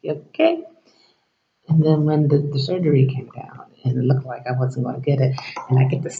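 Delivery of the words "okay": much higher, rising "okay" of disbelief; doubtful okay. Retold or imitated. Patient answering the doctor, high and doubtful. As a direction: sound like you don't quite believe him. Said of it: "and I'm like".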